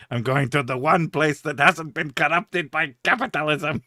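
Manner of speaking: hammed-up theatrical villain with a mock accent; grandly overacted film-villain declamation; Tim Curry. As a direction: chew every word of the scenery. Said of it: "Tim Curry doesn't have an accent. The only accent he has is".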